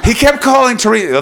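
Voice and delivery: normal talking voice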